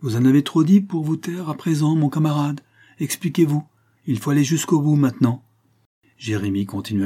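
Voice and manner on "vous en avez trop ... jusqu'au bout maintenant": forceful demand. Acted. Glassmaker pressing suspect hard.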